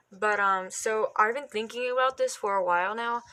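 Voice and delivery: ordinary delivery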